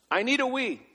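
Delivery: put-on high small child voice; imitated three-year-old; whiny toddler. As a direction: squeak it like a little boy.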